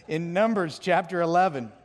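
usual speaking voice

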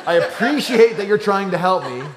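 sweetly polite, the way he does NOT say it; overly polite. Shown as a demonstration. Big brother thanking siblings, mock sugary politeness. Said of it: "he's not saying in this tone".

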